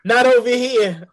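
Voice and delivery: loud, high New York "hey listen"; New York tough guy. Pretend. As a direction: lay on a thick New York accent, loudly. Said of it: "my New York accent".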